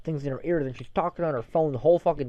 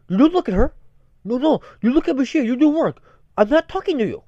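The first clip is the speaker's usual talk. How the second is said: loud, higher, scolding boss voice; stern foreign boss; 8 semitones higher, 6 dB louder than usual. Retold or imitated. Boss to worker looking over, stern scolding. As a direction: order them back to work, raised voice. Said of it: "and the guy's like".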